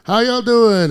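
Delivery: imitated struggling stand-up; comic greeting the crowd. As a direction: call out over a noisy room. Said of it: "like, doing this".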